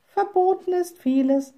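high, defiant exclamation; calling out. A narrator's reading. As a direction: call it out as the little witch. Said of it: "rief die kleine Hexe".

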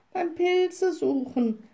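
put-on high, slow little-girl voice; girl adding on. A narrator's reading. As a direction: add it as a girl, drawn out and high. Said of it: "ergänzte das Mädchen". (hohe, langsame Mädchenstimme)

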